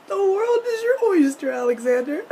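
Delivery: high, loud mock-weepy squeal; gushing fan quote. Fan quoting favourite line, overcome.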